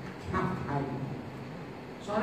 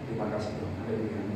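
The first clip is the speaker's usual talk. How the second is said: shy mumbled prayer; 9 semitones lower, 7 dB quieter, faster than usual. Retold or imitated. Shy quiet prayer before eating.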